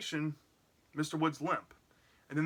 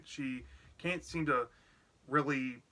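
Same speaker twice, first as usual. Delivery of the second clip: ordinary talk, nothing marked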